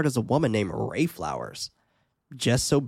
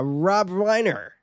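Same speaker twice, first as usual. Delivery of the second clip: announcer voice; 7 semitones higher, much slower than usual. Acted. Drawn-out announcer name.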